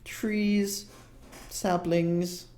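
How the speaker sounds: put-on high, musing old shop woman; shopkeeper woman. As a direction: ponder the order in a high, fussy voice. GM voicing the shopkeeper.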